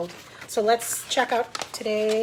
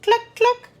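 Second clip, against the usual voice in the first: high, short clucks; chicken cluck; 11 semitones higher, 4 dB louder than usual. A narrator's reading. Chickens clucking in a farm story, high clucks. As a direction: imitate a hen clucking high. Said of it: "went the chickens".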